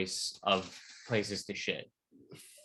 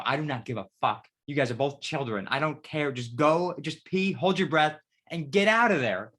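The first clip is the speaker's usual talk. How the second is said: higher, louder scolding that builds; exasperated scolding; 5 semitones higher, 4 dB louder than usual. Retold or imitated. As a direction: scold them like bickering children. Scolding friends as children.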